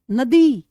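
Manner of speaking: नदी, ending ई stressed and long. Shown as a demonstration. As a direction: lean on the ई at the end of नदी. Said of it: "ई की ज्यादा आ रही है".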